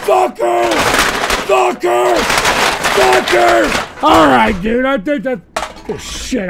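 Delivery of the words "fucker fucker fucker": angry swearing. Real, not acted. Repeated swearing, rising.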